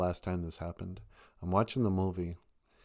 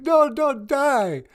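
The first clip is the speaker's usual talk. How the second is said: slow, much higher and louder voice cracking with emotion; real tears breaking through; choked-up plea; 8 dB louder, much slower than usual. Real, not acted. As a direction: near crying, pleading slowly. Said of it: "I'm getting choked up".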